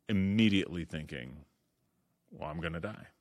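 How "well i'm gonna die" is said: usual speaking voice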